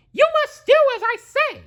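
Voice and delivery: high, fast cartoon mouse command; Mickey ordering fast. Mickey hurriedly ordering the brooms, high fast mouse voice. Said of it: "cried Mickey".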